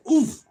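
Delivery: loud, high oof; real grunt from taking a hit; sudden oof as if winded; pained oof. Real, not acted. Hit by dog during rough play.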